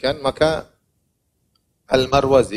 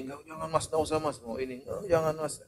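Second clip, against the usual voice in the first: stingy wife; 3 semitones higher, 13 dB quieter than usual. Acted.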